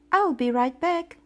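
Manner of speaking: called out loud and high; yelling. A narrator's reading. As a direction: shout it toward the other room. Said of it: "I yelled".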